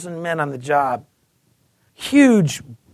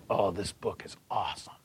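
hushed, secretive whisper; 11 semitones lower, 13 dB quieter than usual. Retold or imitated. Secret aside to coworker.

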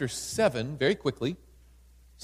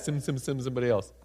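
"Send, send, send" tumbled out fast and lower, softer; quick sheepish mumble; 5 semitones lower, 4 dB quieter, faster than usual. Shown as a demonstration. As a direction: mumble it fast like a reluctant excuse. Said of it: "He probably said it just like that".

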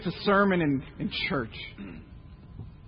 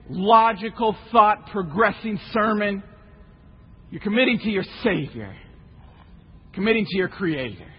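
ordinary talk, nothing marked

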